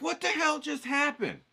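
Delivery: high, slow, loud cry of disbelief; mock outrage. Painter voicing a student's shock at dried watercolor, mock outraged shriek. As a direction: squeal it like a painter betrayed by drying paint. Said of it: "You're all mad".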